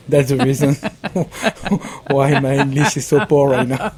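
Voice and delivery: amused, laughing self-mockery. Real, not acted. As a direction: laugh at your own expense while speaking. Joking about his own poor English.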